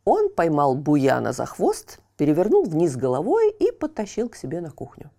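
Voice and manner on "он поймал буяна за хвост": plain reading voice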